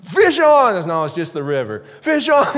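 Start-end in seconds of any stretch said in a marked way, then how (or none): 0.0-0.9 put-on high, loud boyish shout; excited yell. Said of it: "Well, I'm". 2.0-2.5 put-on high, loud boyish shout; excited yell. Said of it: "Well, I'm".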